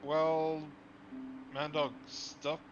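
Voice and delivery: ordinary talk, nothing marked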